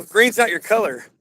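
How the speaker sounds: normal talking voice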